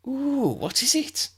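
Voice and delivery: very high eager ooh; child bursting with curiosity; excited little girl. A narrator's reading. Eager Lydia asks.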